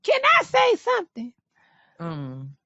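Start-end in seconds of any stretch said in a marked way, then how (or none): 0.0-1.3 very high put-on voice; squeaky-high mimicked interjection; Mama Joyce. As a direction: pitch it way up, mimicking an older mother. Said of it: "Tiny sound like Mama Joyce".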